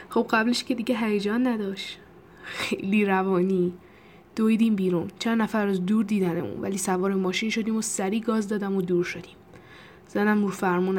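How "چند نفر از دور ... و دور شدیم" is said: plain reading voice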